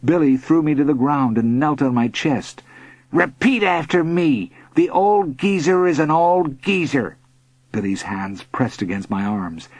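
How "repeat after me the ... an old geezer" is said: lightly voiced bully, raised pitch; bullying demand. A narrator's reading. Bully pinning a boy down, demanding. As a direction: make them repeat it, stretching the words.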